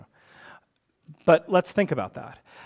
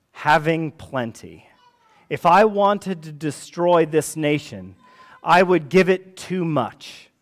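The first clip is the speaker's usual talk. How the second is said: slow, weighty read of a quote, a touch louder; solemn reading; 3 dB louder, slower than usual. Acted. Preacher reading Steinbeck's letter to the congregation, slow and solemn. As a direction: let each phrase land slowly.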